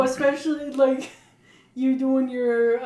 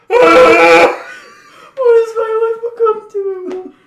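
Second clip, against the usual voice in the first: high, loud wailing cry of a monkey's despair; hammed-up sobbing shout, laughing through it; mock anguished monkey; 9 semitones higher, 12 dB louder than usual. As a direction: wail it like a caged monkey mourning its life.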